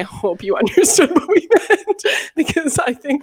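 laughing while talking; giggling through the words. Real, not acted.

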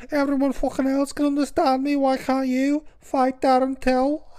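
mangle it in a thick mock Scouse accent; exaggerated Scouse, stretched and barely intelligible; unintelligible Scouse. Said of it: "It just became more unintelligible".

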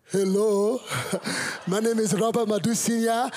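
put-on loud, high Nigerian-accented introduction. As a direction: imitate a proud Nigerian father introducing himself. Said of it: "my Nigerian father woke up the next morning and said".

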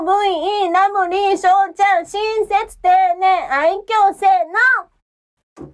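cutesy, slightly chubby Lolita chant; high Lolita voice. Pretend. Singer records the last character, a plump high-voiced Lolita. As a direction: play a sugary girl with cheeks stuffed full. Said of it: "高い方のロリータで、".

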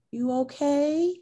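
high, slow, careful check-in; tender concern. Retold or imitated. Girlfriend checking on partner in the car, tender concern. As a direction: ask gently, drawn out and high.

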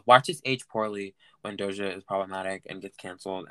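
ordinary talk, nothing marked

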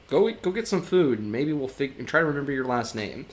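a hint of a flustered hotel worker; perplexed desk clerk.

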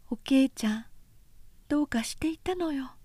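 comforting whisper; hushed whisper. Acted. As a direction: hush her sister gently. Obun soothing her sister.

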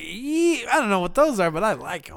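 a hint of a wide-eyed boy; mock dazed kid. His younger self seeing tits in a theater, amazed and loud. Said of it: "I was like".

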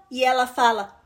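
ordinary delivery

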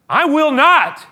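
high, loud refusal; loud denial. Acted. Peter refusing Jesus' prediction, high and loud. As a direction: fling it back.

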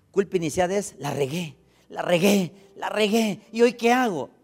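loud, emphatic pulpit preaching, slow on key words; preaching at full force. Acted. Pastor preaching loudly to his congregation about guilt.

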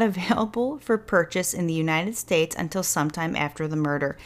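ordinary talk, nothing marked

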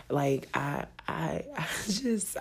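ordinary talk, nothing marked

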